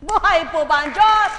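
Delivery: loud, rousing call to the crowd; preacher's call. Real, not acted. Preacher asks the congregation, loud rallying call. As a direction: shout it out to the congregation.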